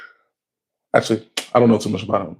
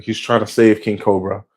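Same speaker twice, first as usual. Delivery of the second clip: ordinary delivery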